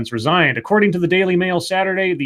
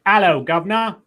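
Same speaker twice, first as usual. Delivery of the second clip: hammed-up British greeting; mock British accent; 4 semitones higher than usual. Host riffs on the British category, mock British accent. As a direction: put on a joke British accent. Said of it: "The British".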